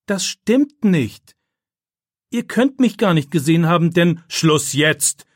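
commanding teacher voice cutting him off; stern command. A narrator's reading. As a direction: lay down the law to the boy. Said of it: "befiehlt Herr Wagner".

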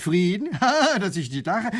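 lieutenant laughing off the talk of peace; scornful laugh. Acted. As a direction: laugh at the very idea. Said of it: "lacht der Leutnant".

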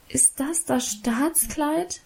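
disappointed, reproachful. A narrator's reading. Girl sees her old dress, disappointed and reproachful. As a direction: ask it softly and slowly, hurt and accusing. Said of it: "fragte ich enttäuscht und vorwurfsvoll".

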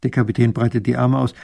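ordinary delivery, nothing marked

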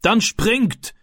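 high, urgent call to jump; shouted command. A narrator's reading. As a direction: call out an order from the bank. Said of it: "rief der Mann".

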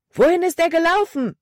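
high-pitched urgent shout; loud call. A narrator's reading. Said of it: "rief Caleb laut". (laut und hoch gerufen)

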